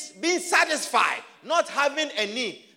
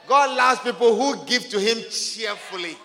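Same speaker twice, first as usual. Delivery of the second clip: ordinary talk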